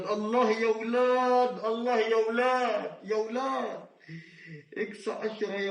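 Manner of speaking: real thrilled cheer over a big multiplier; ecstatic cry. Real, not acted. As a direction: shout it out in real joy at the win. Hitting x10 win.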